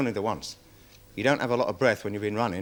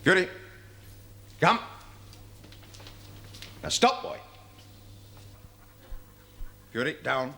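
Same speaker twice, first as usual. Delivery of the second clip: dog commands; 6 dB quieter than usual. Real, not acted. Handler giving his dog commands before the court, firm and clipped. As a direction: command the dog crisply and clearly.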